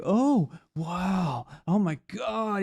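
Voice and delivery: hammed-up wonder, high swooping vowels; mock awestruck. Host mimicking interviewer listening to Aaron, mock awe. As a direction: gush like you're hearing a great sage. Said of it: "he acts like he's listening to Yoda".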